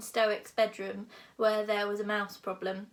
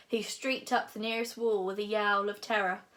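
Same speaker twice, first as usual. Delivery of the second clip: plain reading voice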